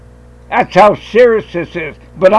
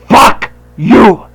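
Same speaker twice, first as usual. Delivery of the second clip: retold curse; 3 semitones higher, 4 dB louder than usual. Retold confrontation with FBI agent.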